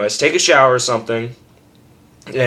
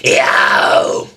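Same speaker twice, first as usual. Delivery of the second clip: a short harsh shouted yo; scream demo; 2 semitones higher, 4 dB louder than usual. Shown as a demonstration. Teaching screaming to viewers, a harsh shouted yo. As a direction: throw out a raw scream. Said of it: "That's what it's gonna sound like".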